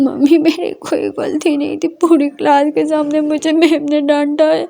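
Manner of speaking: a light touch of crying while explaining. A narrator's reading. Daughter tells mother she wasn't at fault, lightly tearful. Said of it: "Ishani रोते हुए बोलती है".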